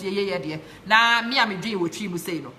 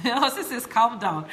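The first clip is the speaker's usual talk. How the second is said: laughing; 6 semitones higher, 5 dB louder than usual. Real, not acted. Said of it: "I get very passionate".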